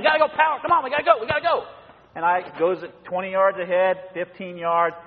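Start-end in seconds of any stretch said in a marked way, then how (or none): 0.0-1.6 higher, brisk urging to hurry; a power-walking wife hurrying her husband on. Retold or imitated. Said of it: "Get that power walk again".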